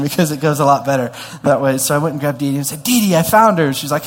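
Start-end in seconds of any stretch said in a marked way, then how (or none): 2.8-3.7 high, loud burst of news; re-enacted excitement, raised and loud; excited announcement. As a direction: call out the discovery to a friend. Retelling his excited news to friend.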